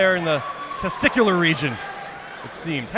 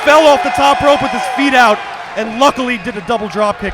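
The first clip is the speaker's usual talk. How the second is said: excited commentary; 5 semitones higher, 6 dB louder than usual. Real, not acted. Commentator reacts to lucky move.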